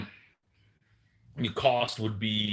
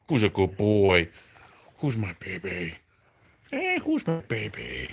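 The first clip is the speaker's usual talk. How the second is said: talking to a dog. Real, not acted. Baby talk to dog.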